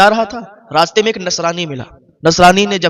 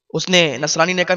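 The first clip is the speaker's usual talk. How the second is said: ordinary delivery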